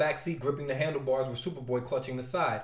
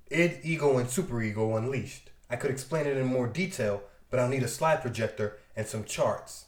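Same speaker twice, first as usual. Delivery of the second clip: lower, even robot voice; android voice; 4 semitones lower than usual. Acted. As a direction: lecture like a calm android. Red Tornado voiced.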